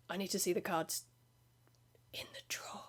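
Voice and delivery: low hushed whisper; shifty whisper. Retold or imitated. Customer insisting on the secret drawer, whispered. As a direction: whisper like a furtive dealer. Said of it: "shifty little eyes".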